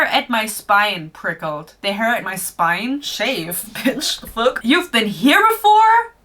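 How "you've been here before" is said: high, slow astonished question; surprised question. Acted. Heroine asking the creature in shock, high and surprised. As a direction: ask it high and stunned.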